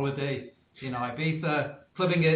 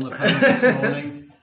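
laughing; 8 dB louder, slower than usual. Real, not acted. Jokes about being senior.

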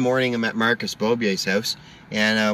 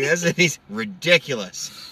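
ordinary delivery

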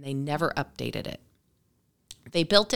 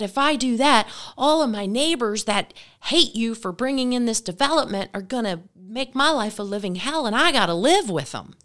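worked-up refusal; 4 semitones higher, 5 dB louder than usual. Retold or imitated. Acted neighbor's heated refusal.

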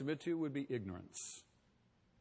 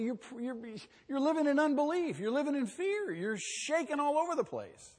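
normal talking voice

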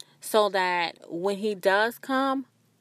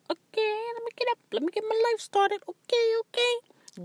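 much higher squeaky mock-eager voice; hammed-up startled compliance, pitched way up; flustered high reply. To the spirit, scrambling to obey in a high mock voice.

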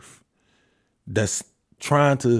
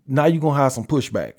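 ordinary delivery

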